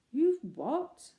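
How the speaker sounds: taken aback; lady astonished at the rescue; surprised. Acted. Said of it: "The lady seemed most surprised".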